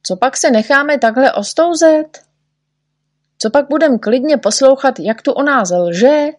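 indignant protest. A narrator's reading. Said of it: "volal doktor Pilulkin".

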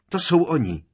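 high, loud cry of alarm; shriek. A narrator's reading. Hermiona spotting the arrivals overhead, shrieking. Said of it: "vřískla Hermiona".

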